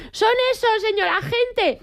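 high, eager call to the police; gleeful neighbour calling down to the officers; excited tattling shout. Retold or imitated. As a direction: point them out like a triumphant snitch. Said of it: "cuando llegaron grité".

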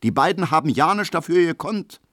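high-pitched Berlin protest; soldier calling out. A narrator's reading. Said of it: "ruft ein Soldat". (hoch und laut eingeworfen)

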